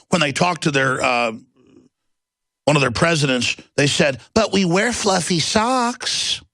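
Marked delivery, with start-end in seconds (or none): 4.4-6.4 mock innocent. Mocking tech executives' excuse.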